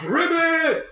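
high-pitched mock frog scream. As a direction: croak it out as a hurt frog's shriek. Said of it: "he kinda screams out".

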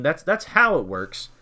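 ordinary talk

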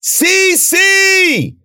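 very high, loud, long shout; drawn-out desperate holler; calling out. A narrator's reading. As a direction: scream the name into the storm. Calling for her in the storm.

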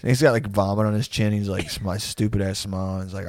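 ordinary talk

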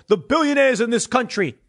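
a hint of Bernie's rally bellow; lightly voiced, in Bernie Sanders' rally cadence; mock Bernie Sanders yell. Commentator imitating Bernie Sanders yelling to the crowd, mild mock yell. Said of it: "he yells to all the people".

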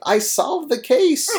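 high, drawn-out retold cry; mock triumphant yell. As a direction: announce it slowly like a hammed-up victory cry. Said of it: "she runs out of the bar yelling".

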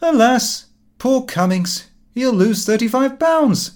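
high and loud mock grief; gleeful fake pity; unseemly mock lament. A narrator's reading. Son mocking the absent friend's loss, high and gleeful. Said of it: "in the most unseemly manner said".

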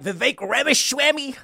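mock the way a brash politician mangles "Ramaswamy"; Trump impression. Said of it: "that's what Trump says".

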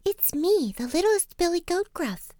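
tiny high-pitched billy goat; little bit of voice. A narrator's reading. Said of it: "in his little bit of voice".

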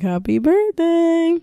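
high, drawn-out party cheer; mock celebratory. Mom presenting a cardboard car, mock celebratory. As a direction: stretch it out high in fake festivity.

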